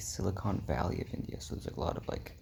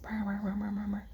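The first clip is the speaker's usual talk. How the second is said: high buzzing honks, mimicking horns; imitated car horns; 13 semitones higher than usual. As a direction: toot like the impatient horns.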